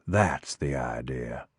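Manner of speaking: low, soft, slow "That's the idea"; cold menace. Acted. Eddie answers coldly.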